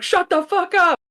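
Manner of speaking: high, loud mock yell out a window. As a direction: yell at coyotes outside. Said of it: "he'd be like".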